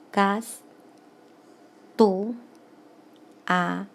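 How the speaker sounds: very slow syllables with long silences between; deliberate syllable count. Shown as a demonstration. Teacher recounting for students who missed it, very spaced-out syllables. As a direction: leave a long gap after every single syllable. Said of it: "vamos a contar nuevamente".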